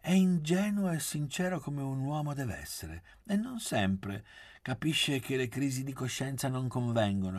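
high-pitched, earnest defence; earnest conviction. A narrator's reading. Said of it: "disse Gabriella convinta".

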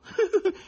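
high, loud gloating cackle; mocking cackle. A narrator's reading. Young relative laughing at the wife, cackling. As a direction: cackle in triumph. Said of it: "rispose sghignazzando".

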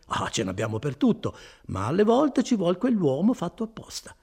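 animated, swooping explanation; lightly played friar, spinning out a theory; lightly voiced chatty friar. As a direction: talkative friar with lively pitch swings. Voices Fra Galdino in dialogue.

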